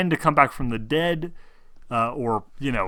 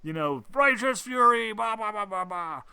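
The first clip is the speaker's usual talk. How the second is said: high mock war cry trailing off; half-hearted hammed-up fury shout; mock battle cry; 7 semitones higher, 8 dB louder, slower than usual. GM joking how a player enters Righteous Fury, mock shout. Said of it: "inspire you to go, like".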